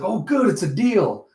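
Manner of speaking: relieved buyer agreeing; relieved deal. Acted. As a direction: savor closing the deal. Lemire relieved, closing deal.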